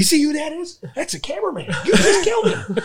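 high disbelief, laughing; mock horror at the body; retold shocked cry. Retelling the scene, he voices high laughing shock at Tim. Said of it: "I go".

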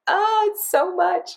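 high, slow overwhelmed whine; overwhelmed sigh. Retold or imitated. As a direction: stretch it out high, swamped. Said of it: "I was just like".

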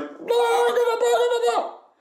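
very high squeaky doggy pestering; squealed, urgent pet voice; insistent dog voice. Acted. Voicing the dog interrupting her game.

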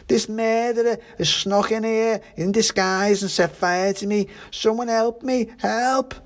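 put-on high-pitched drunken pudding wailing for help; frantic accusation turning to a high cry; shouted panic. A narrator's reading. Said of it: "shouted the pudding".

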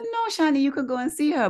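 a light imitation of a friendly carer; carer's voice. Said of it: "She said".

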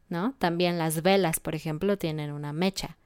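ordinary delivery

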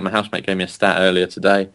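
usual speaking voice

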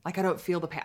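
ordinary talk, nothing marked

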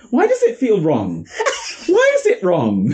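puzzled voice breaking into laughter; half-laughing, baffled at the bowl; laughing confusion. Retold or imitated. Confused baker laughing at her own mixture. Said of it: "looking at my mixture like".

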